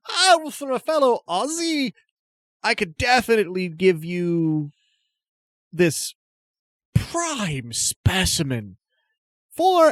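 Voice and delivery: high, hammy fake Aussie accent; bad Australian accent. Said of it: "in a tone of voice that has nothing to do with an Australian accent".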